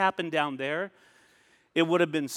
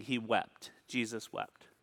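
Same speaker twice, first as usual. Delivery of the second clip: much softer, lower, hushed; hushed solemn; 6 semitones lower, 11 dB quieter, slower than usual. Acted. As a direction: let it fall to a near hush. Solemn moment after Lazarus story.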